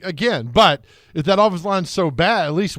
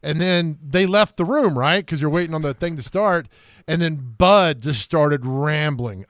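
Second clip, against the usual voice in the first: ordinary talk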